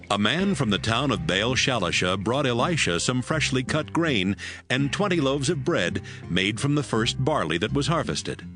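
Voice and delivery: ordinary talk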